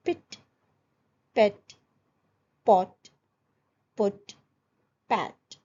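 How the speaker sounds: clipped short vowels pit, pet, pot, put, pat; short vowel demo. Shown as a demonstration. Teacher demonstrating short vowels to class, crisp. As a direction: say each word quick and short. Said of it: "थोड़ी सी देर के अंदर produce हुआ और खत्म".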